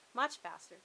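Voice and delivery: ordinary delivery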